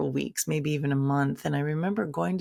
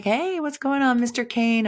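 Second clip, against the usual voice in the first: cheery greeting; 7 semitones higher, 5 dB louder than usual. Retold or imitated. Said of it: "I walk in there and I'm like".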